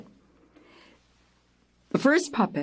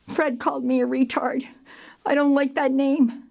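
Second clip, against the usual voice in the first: put-on higher-pitched, mildly weepy girl; tearful complaint; 7 semitones higher than usual. Girl on the sidewalk to the director, mock tearful. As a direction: imitate an upset girl, pitched up. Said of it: "she's crying and crying".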